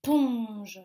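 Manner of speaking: PONGE alone, loud and drawn out; isolated syllable. Shown as a demonstration. Teacher confirms the second syllable, PONGE alone and stretched. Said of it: "dans la deuxième syllabe".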